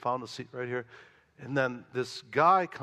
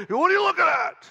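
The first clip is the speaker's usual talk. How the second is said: much higher, much louder mock yell; retold screaming; 8 dB louder than usual. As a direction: bellow it like the angry man on the subway. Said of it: "Like that".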